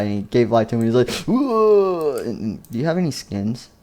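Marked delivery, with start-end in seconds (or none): 1.3-2.2 high, drawn-out whoa; amazed cry. Acted. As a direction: swoop up high in wonder. Character suddenly comes to life.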